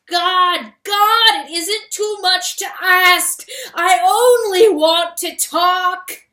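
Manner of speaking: a high, loud, slow wail to the sky. A narrator's reading. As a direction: wail at the top of your lungs. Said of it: "wailed at the top of his lungs".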